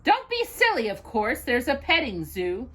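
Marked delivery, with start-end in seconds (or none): none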